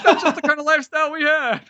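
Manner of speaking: high, loud mock narrator; George Lazenby. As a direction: send up a cheerfully shameless memoir voice. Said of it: "It's just like".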